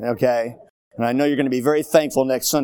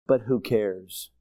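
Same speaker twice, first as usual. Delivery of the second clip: usual speaking voice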